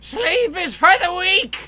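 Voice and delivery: high, loud, slow proclamation; mock battle cry. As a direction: declare it like a warrior.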